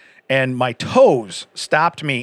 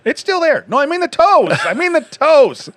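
laughing high correction; 14 semitones higher, 6 dB louder than usual. Real, not acted.

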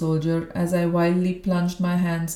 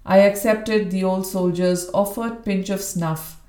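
ordinary delivery, nothing marked

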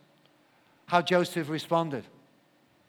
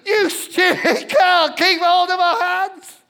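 much higher and louder, slow, with a cry in the voice; panicked scolding shout; 11 semitones higher, 9 dB louder, slower than usual. Retold or imitated. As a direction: yell it out of pure relief and panic. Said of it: "we went up to her and said".

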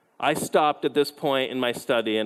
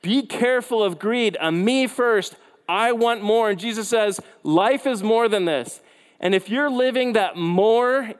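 higher, louder preaching voice, driving the point home; impassioned preaching; 6 semitones higher, 3 dB louder than usual. Acted. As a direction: preach it with rising urgency. Preaching at full force.

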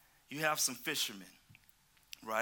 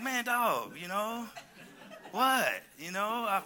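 higher, louder tired fisherman griping; Simon complaining; 6 semitones higher, 4 dB louder, slower than usual. Retold or imitated. Preacher voicing Peter to the church, griping. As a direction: gripe like a worn-out fisherman at shift's end. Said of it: "get into the mind of Simon".

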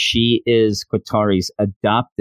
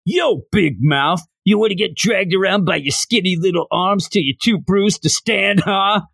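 put-on over-the-top old man puppet, pitched high; Bigo; 9 semitones higher than usual. Toy puppet snaps at the student who hates kids, over-the-top old man voice. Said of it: "comes out in an old man's voice".

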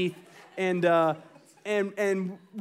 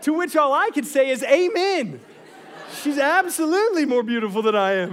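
laughing loudly and higher; 7 semitones higher, 5 dB louder than usual. Real, not acted. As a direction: burst out laughing through the words. Laughing at son's punchline.

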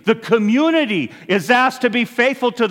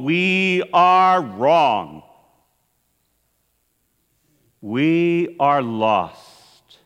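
slow grave emphasis; 4 semitones lower, much slower than usual. Acted. Preacher's slow emphatic conclusion.

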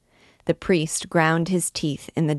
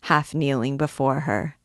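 ordinary delivery

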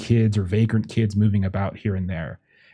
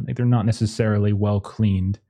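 normal talking voice

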